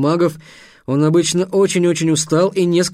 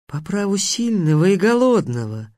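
lofty monster intoning; menacing sphinx; 4 semitones higher than usual. Acted. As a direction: state your claim with hungry pomp.

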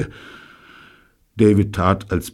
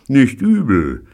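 David conceding; 9 semitones higher, 7 dB louder, slower than usual. Acted. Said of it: "gestand David".

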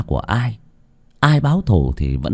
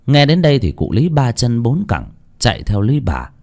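ordinary delivery

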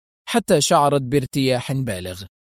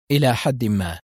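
ordinary talk, nothing marked

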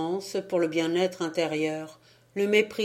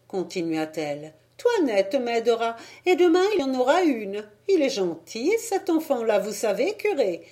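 put-on higher, louder fond old woman; fond old lady; 6 semitones higher, 4 dB louder than usual. A narrator's reading. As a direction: praise the child to the priest. Old lady praises the boy. (vieille demoiselle attendrie, voix aiguë)

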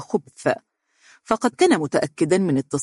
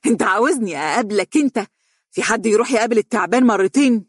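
higher, fast, incredulous cry; agitated, raised exclamation; exclaiming; 6 semitones higher, 3 dB louder, faster than usual. Acted. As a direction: call it out in disbelief. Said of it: "وهي تهتف".

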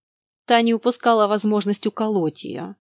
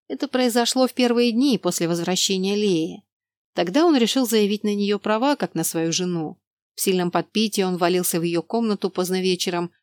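ordinary delivery

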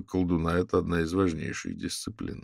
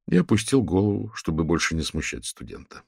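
ordinary delivery, nothing marked

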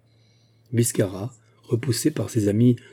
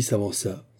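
plain reading voice

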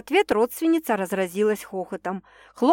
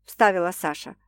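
plain reading voice

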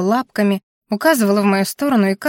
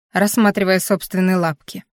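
ordinary delivery, nothing marked

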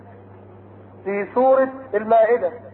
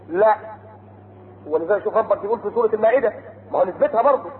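normal talking voice

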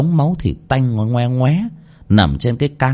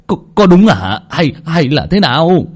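loud, higher stammering demand; shouting; 7 semitones higher, 5 dB louder than usual. Acted. Villagers shouting at Tèo for the truth, loud and stammering. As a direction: bark the question at the frightened boy. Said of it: "đám người quát to".

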